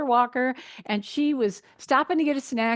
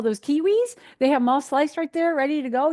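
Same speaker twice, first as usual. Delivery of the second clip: high, louder, eager pointing-out; retold eager suggestion; 6 semitones higher, 5 dB louder than usual. Dietitian retelling tips to airport stranger, eager. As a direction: re-enact pitching a helpful alternative.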